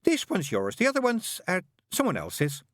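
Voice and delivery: high, bright-voiced chattering nun; fussy nun. A narrator's reading. Nun greeting the father in a high voice. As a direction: pitch it high like a flustered nun. Narrator voices Sister Mary.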